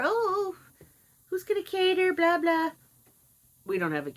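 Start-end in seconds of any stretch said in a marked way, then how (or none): 0.0-2.7 high, chirpy mock of my mother; higher put-on mother voice rattling on; mocking the mother's chatter, pitched up; imitating mother. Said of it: "my mother's saying".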